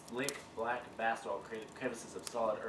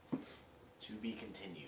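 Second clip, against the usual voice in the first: slow, soft sign-off; dramatic closing; 8 dB quieter, slower than usual. Acted. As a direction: trail off slowly like a serial ending.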